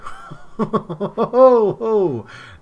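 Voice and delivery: loud high burst of laughter; delighted cackle; gleeful laugh. Real, not acted. Laughs at board caving.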